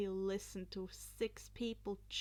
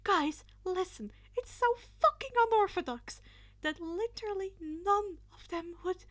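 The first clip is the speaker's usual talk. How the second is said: much higher, louder eager pitch; excited insistence; 10 semitones higher, 8 dB louder than usual. Acted. Izuku excitedly defending his unorthodox plan. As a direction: sell the crazy idea eagerly.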